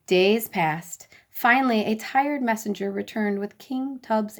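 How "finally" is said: ordinary delivery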